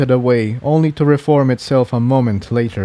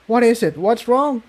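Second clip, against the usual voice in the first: put-on higher worried young pony; Tiptoe worried; 11 semitones higher than usual. Tiptoe asking Jigsaw what is wrong, worried. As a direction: ask anxiously in a high voice.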